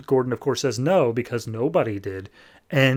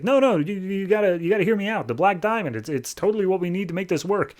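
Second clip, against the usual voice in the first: higher, urgent begging; a raised, insistent plea; pleading Gordon; 7 semitones higher, faster than usual. Retold or imitated. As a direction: beg him to listen.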